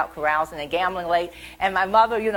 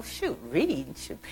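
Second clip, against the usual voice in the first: mild mock huff, muttered; imitated childish sulk; grumbling child; 3 semitones lower, 5 dB quieter, slower than usual. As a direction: grumble like a put-off kid. Child grumbles after brush-off.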